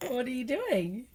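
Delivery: gently prompting, stretched out; coaxing question. Real, not acted. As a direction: ask slowly, coaxing a little one. Parent coaxing toddler to talk.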